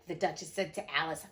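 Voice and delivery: plain reading voice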